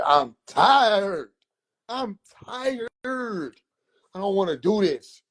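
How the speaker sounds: put-on whining high like a worn-out kid; whiny complaint. Nephew whining at his uncle during exercise, high complaint. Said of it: "I said".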